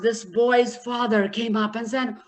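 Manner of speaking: normal talking voice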